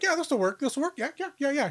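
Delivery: high, squeaky-light rapid assurances; mock eager agreement. Teammate approving a mashed-together plan, high mock eagerness. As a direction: chirp along in a put-on high voice. Voicing a team's hasty, makeshift plan.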